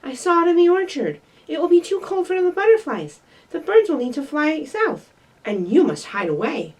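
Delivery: storybook-style urgent telling. Acted. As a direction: rush it out like you ran all the way. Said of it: "panted Fletcher".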